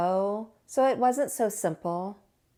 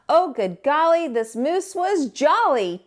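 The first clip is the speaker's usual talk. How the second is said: higher, louder and slowing gleeful cheer; jolly; 5 semitones higher, 5 dB louder than usual. A narrator's reading. Narrator cheering about the jolly moose, higher and louder. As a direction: burst out with holiday joy.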